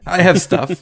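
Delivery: mock-insistent character quote; Moira. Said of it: "Moira's like".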